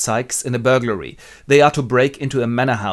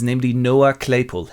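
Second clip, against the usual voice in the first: plain reading voice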